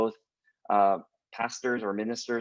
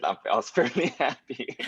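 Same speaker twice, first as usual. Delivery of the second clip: quoting laughing. Retold or imitated. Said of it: "and then you said".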